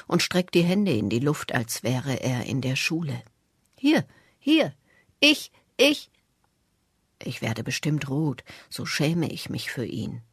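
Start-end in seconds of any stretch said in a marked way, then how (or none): 3.8-6.1 eager calling out. A narrator's reading. Old man begging like schoolchild.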